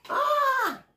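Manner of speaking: ordinary talk, nothing marked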